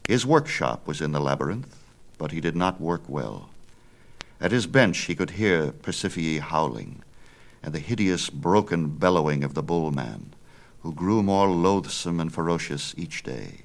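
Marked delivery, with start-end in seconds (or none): none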